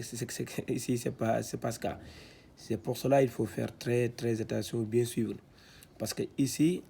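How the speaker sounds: ordinary talk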